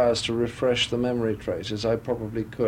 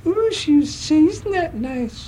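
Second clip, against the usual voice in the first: very high, slow, silly showgirl; drawn-out high-pitched comic impression; 5 dB louder than usual. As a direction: coo it in a silly falsetto. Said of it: "she talked like this".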